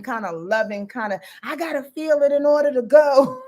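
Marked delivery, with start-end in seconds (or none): 1.4-3.4 louder, whiny mock-tearful plea; mock emotional. Mocking everybody being emotional in Cancer Mars, hammed-up whine. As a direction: put on an overwrought, emotional whine. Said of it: "emotional about a thing".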